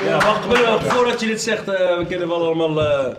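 high, loud urgent wait; excited interruption. Real, not acted. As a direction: cut in loudly and eagerly. Interrupts loudly to add something.

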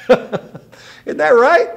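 laughing through the words, high and drawn out. Real, not acted. Preacher laughing with the congregation at the joke, amused. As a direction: chuckle at the punchline.

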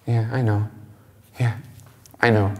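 unimpressed. Retold or imitated. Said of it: "the emotion on her face is, like, unimpressed".

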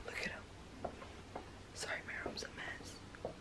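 barely audible whisper; hush, the dog is sleeping. Real, not acted. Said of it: "so I'm gonna try and whisper".

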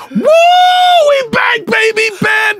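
very high, slow hype shout. Retold or imitated. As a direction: cry it out like a victory yell.

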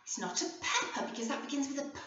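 normal talking voice

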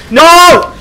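high, loud burst of protest; sudden frustrated yell. Real, not acted. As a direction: cry out as it goes wrong. Sudden mishap in game.

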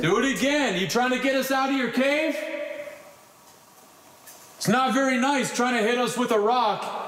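high, challenging call to a spirit; taunting the unseen; provoking. Real, not acted.

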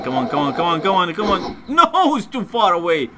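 rising frantic urging, ending in a loud protest; urgent pleading. Real, not acted. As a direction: egg it on, then cry out. Racing the wind, then failing.

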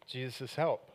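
usual speaking voice

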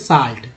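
साल्ट with long 'aa', like 'saalt'; correct long 'aa'. Shown as a demonstration. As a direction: open and lengthen the vowel of साल्ट. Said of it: "क्या बोल रहे हैं".

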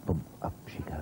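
hushed whisper as ball flies. Real, not acted. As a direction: whisper the shot away. Shot struck during hushed commentary.

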